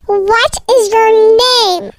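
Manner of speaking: slow, drawn-out demand; insistent demand. Acted. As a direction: stretch every word, pressing for an answer. Kidnapper's third, insistent ask.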